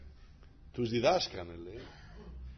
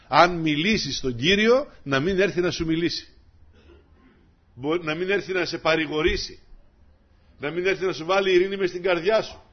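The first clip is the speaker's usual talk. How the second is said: building louder and higher preaching; rising to a full preaching cry; building sermon; 3 semitones higher, 8 dB louder than usual. Acted. (κήρυγμα που δυναμώνει)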